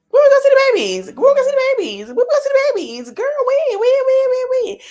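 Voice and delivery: high, loud, squeaky mock fan voice, repeating fast; pestering fan asking the mom to show her babies, mock squeal; mocking nagging fans. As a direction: parody the fans pestering her, shrill and insistent. Mocking fans begging to see babies.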